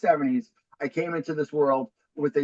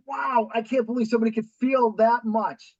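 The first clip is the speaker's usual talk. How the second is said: higher-pitched mock amazement; imagined amazement; 8 semitones higher than usual. Guest voices listeners amazed at his failures, acted amazement. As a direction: voice an astonished listener.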